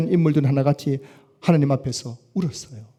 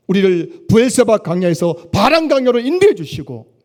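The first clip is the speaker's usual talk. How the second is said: louder, higher fervent proclamation; preaching at full force; 5 semitones higher, 6 dB louder than usual. Acted. Pastor proclaiming God's miracles in full fervour. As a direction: build each phrase with rising fervour. Closing sermon climax.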